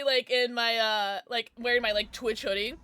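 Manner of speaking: ordinary talk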